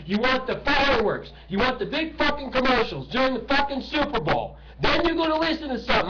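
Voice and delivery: real furious yelling tirade; furious rant. Real, not acted. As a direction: let the rage boil over at the audience. Ranting at the media and celebrities.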